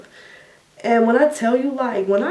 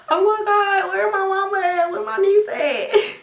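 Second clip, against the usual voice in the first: much higher, frantic plea for family; panicking; 12 semitones higher than usual. Retold or imitated. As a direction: call out for mama in a daze of panic. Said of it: "I'm like".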